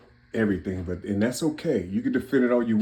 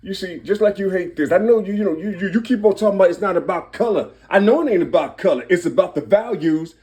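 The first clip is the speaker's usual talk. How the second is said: high, loud, building sermon-style shouting; fired-up preaching; 8 semitones higher, 5 dB louder than usual. Real, not acted. Man preaching numerology to his audience, fired-up and loud. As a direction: raise the pitch and volume, hammering each question home.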